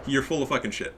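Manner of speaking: ordinary talk, nothing marked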